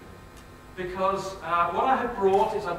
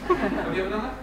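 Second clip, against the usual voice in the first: ordinary talk, nothing marked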